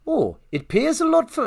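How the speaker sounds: hammed-up Geordie lilt, pitched high; accidental Geordie accent. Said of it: "that's Geordie again. I'm doing Geordie".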